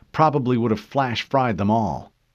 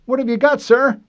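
high, loud anxious question; urgent voice of a young soldier; young soldier asking; 9 dB louder than usual. A narrator's reading. Fincher calling up to his corporal, anxious. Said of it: "Fincher asked".

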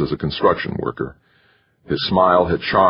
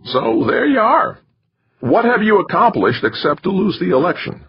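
wiry manager voice; 14 semitones higher than usual. A narrator's reading. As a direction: lay it out to him like a lecture. Narrator voicing McChesney.

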